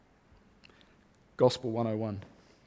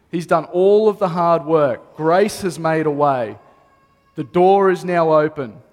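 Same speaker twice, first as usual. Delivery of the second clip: louder, ringing declaration from the pulpit; preaching at full force; 3 semitones higher, 6 dB louder than usual. Acted. Pastor to his congregation, proclaiming louder with full force. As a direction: proclaim it to the whole room, raised.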